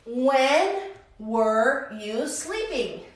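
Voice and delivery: very slow, word by word; slow model question. Shown as a demonstration. Teacher models a question for students, slow and spaced out. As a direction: space out each word for learners.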